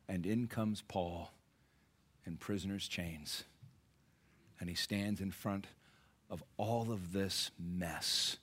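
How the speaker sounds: soft, slow, dropped voice; Paul's entrance in chains told softly and slowly; hushed dramatic telling. Acted. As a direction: let it sink into quiet. Paul's humble entrance contrasted.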